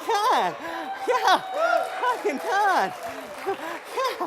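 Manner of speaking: high, bright whoops of joy; a healed man cheering as he dances, high and gleeful; excited. Acted. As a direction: sing it out, overjoyed he can move. Celebrating that he can dance.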